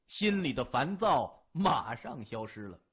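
ordinary delivery, nothing marked

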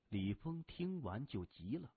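plain reading voice

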